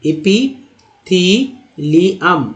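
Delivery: "epithelium" very slowly as i-pi-thee-li-um; very slow model of "epithelium"; syllable-by-syllable. Shown as a demonstration. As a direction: stretch each syllable of "epithelium". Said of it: "next है".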